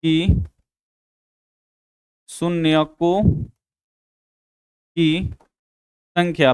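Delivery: word by word, slow and drawn out, as if writing; slow dictation. Shown as a demonstration. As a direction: dictate each word slowly for the class to copy. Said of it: "तो लिखिएगा".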